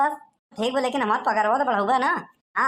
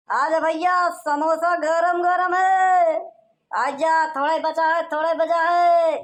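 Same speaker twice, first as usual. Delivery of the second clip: loud, drawn-out street vendor cry; slow singsong hawking shout; hawker's call; 6 semitones higher, 4 dB louder, slower than usual. Acted. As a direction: call out to passersby like a samosa seller. Vendor calling out selling samosas.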